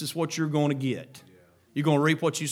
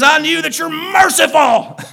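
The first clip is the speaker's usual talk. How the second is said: grudging Jonah; 12 semitones higher, 13 dB louder than usual. Acted. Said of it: "He says".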